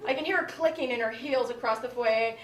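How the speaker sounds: ordinary talk, nothing marked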